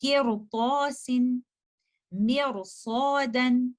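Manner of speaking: किरतौसिन with a heavy, full-mouthed ra, drawn out slowly; slow Quranic recitation of किरतौसिन, मिरसौदन. Shown as a demonstration. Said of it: "आप पढ़ेंगे किरतौसिन". (भारी रा के साथ धीरे किरतौसिन)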